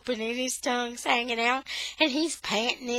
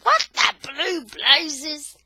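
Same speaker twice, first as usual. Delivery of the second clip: put-on slow, louder, higher old-man exclamation; blustery grandpa, loud and slow; Grandpa T's outburst; 7 semitones higher, 8 dB louder, slower than usual. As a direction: drag it out like an exasperated grandpa. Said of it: "Grandpa T comes right behind her saying".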